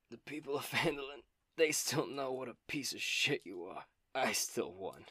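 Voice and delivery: put-on voice of a gloating, dying villain. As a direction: taunt them with your last breath. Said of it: "He just kinda coughs up blood".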